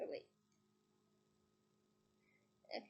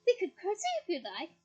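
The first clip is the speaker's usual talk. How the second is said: mocking twin; 6 semitones higher, 7 dB louder than usual.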